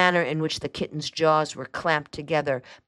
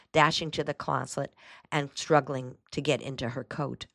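ordinary delivery

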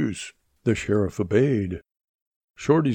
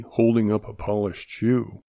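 usual speaking voice

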